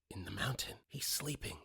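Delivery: put-on hushed child whisper. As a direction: whisper it like a secret.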